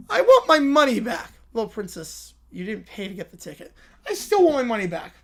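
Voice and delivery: usual speaking voice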